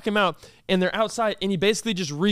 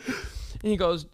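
ordinary talk, nothing marked

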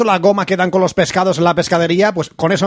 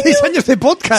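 surprised; 11 semitones higher than usual. Real, not acted. Disbelief at six years.

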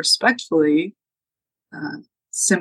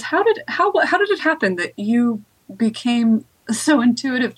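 curious witch; 6 semitones higher than usual. Acted. Baba Yaga, curious.

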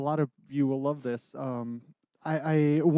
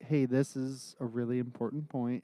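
quiet; 3 semitones lower, 7 dB quieter than usual. Shown as a demonstration. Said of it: "make sure that it varies".

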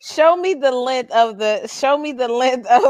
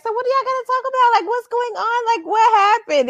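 hype host; 10 semitones higher, faster than usual. Retold or imitated. Mimicking host engaging audience.

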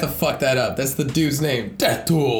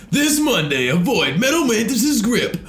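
monster truck ad; 5 semitones higher, slower than usual. Pretend. Continues the truck ad gag.

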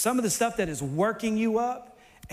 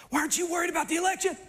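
rapid worried question; 6 semitones higher, much faster than usual. Retold or imitated. Someone presses the speaker.

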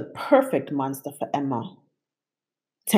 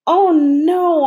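much higher, louder alarmed outburst; blurted; 12 semitones higher, 8 dB louder than usual. A narrator's reading. Narrator seeing Emma have fun, alarmed blurt. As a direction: let it burst out in panic. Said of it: "I blurted".

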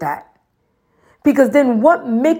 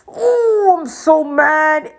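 high, drawn-out mock whine of a mad kid; mock inner fuming; 6 semitones higher, much slower than usual. Child fuming silently at a scolding parent, mock whiny. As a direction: whine it like a sulking child in your head. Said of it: "in your head, you're like".

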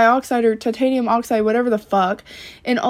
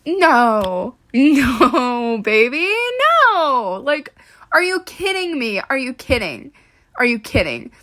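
exasperated disbelief. Real, not acted. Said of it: "that makes me so".

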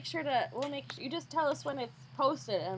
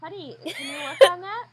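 playful coaxing; 7 semitones higher, 11 dB louder than usual. Acted. Teasing the dog to practise.

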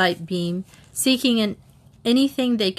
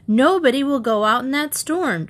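firm, higher-pitched refusal; determined; 6 semitones higher, 3 dB louder than usual. A narrator's reading. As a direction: forbid it with determination. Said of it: "Trixie said determinedly".

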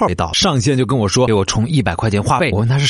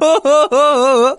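high giggle; 4 dB louder than usual. Acted. Scammer laughs again.

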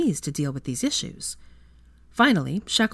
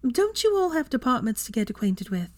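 arch; 8 semitones higher than usual. A narrator's reading. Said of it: "Hermione asked archly".

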